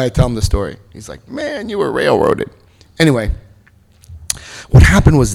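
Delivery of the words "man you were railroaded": sympathetic newcomer. Retold or imitated. Newcomer's sympathetic reaction retold.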